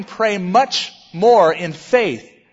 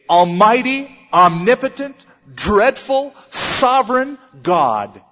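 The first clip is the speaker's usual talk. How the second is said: higher, louder titles, slowing to a solemn close; mock grandiose; 4 semitones higher, 4 dB louder, slower than usual. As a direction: pile up lofty titles pompously. Mock grandiose address to distant God.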